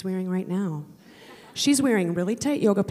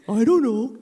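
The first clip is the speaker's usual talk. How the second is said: put-on louder, offhand teen mumble; sulky teen; 6 dB louder than usual. Daughter answers mom, a bored teen shrug. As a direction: toss it back like a bored kid.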